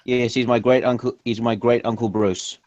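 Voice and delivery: normal talking voice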